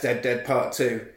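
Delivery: ordinary talk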